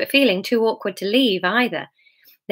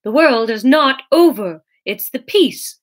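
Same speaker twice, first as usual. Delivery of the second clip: louder, higher, snappish retort; snapped; 4 semitones higher, 6 dB louder than usual. A narrator's reading. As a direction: snap back with force. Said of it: "snapped Jesus".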